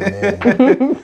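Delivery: high, loud mimicry of an amen; mock someone busy shouting along; mocking shouted amen. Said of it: "you are busy shouting".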